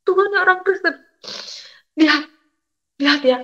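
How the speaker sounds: high, imploring call to God; retold tearful appeal. Mother begs God to look, retold tearful appeal. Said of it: "Saya menangis di belakang kosan".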